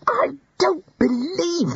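high, slow, strained outburst of disbelief; exploding. Acted. Ponder to the wizards, exploding in high disbelief. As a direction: burst out in shocked disbelief. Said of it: "Ponder exploded".

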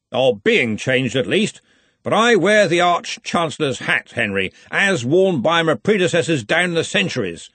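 a hint of a pompous Arch-Chancellor; Ridcully, lightly voiced. A narrator's reading.

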